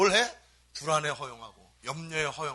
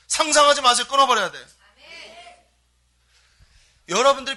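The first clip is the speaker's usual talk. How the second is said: loud, higher pleading sermon voice; fiery preaching; 7 semitones higher, 12 dB louder than usual. Acted. Pastor urges congregation to stop imagining, loud and fervent. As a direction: drive it home to the pews.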